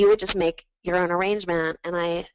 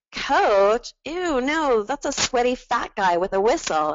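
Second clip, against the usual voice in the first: higher-pitched mock focus-group reaction; disgusted objection; 4 semitones higher than usual. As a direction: recoil at the idea in an imitated objector's voice. Imagined focus-group member objecting.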